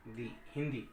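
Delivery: हिंदी with दी stretched long; drawn-out दी. Shown as a demonstration. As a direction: draw out the दी in हिंदी. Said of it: "ही जल्दी बोला गया, दी समय लिया". (हिंदी में दी को खींचकर लंबा बोलना)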